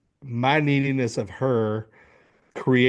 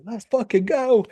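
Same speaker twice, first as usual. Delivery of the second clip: much higher, hyped-up cheer to yourself; excited cheer; 11 semitones higher than usual. Retold or imitated. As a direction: pump yourself up after a big sale. Celebrating sales in office.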